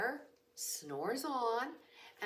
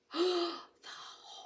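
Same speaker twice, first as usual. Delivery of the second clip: shocked gasp; 9 semitones higher, 4 dB louder than usual. Acted. Crowd freezes in shock.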